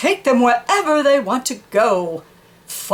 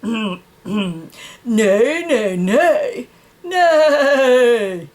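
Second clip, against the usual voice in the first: whinnying; much slower than usual. Shown as a demonstration. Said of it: "You get the idea".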